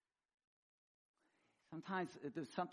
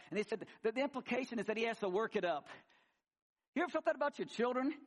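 ordinary talk

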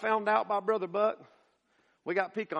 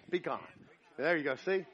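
ordinary talk

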